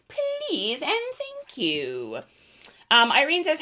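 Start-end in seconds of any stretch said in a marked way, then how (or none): 0.1-2.2 sing-song please. Pretend. Playful sing-song after covering costs.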